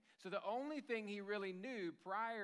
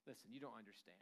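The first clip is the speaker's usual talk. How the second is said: low, muted exasperation; eye-rolling impatience; 5 semitones lower, 10 dB quieter than usual. Acted. Grown child dismissing dad's advice, exasperated. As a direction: brush off advice with an audible eye roll. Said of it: "you rolled your eyes and said".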